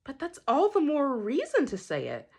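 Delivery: high, swooping put-on voice; imitating an insistent stranger. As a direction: put on the lady's insistent retort, pitch leaping up. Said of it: "she says".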